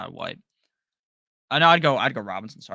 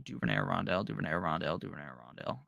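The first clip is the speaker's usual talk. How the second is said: muttering to himself; 7 semitones lower, 11 dB quieter, faster than usual. Real, not acted. Mulling a choice to himself.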